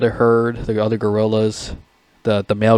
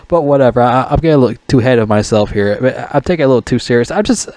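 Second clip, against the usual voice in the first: usual speaking voice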